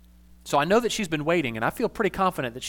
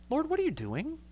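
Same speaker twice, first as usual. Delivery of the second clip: higher but much softer, sweetened question; more palatable; 11 semitones higher, 6 dB quieter than usual. Shown as a demonstration. Pastor rephrasing his complaint to God, sweetened and soft. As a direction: soften it so it sounds nicer. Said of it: "in a way that ... is more palatable".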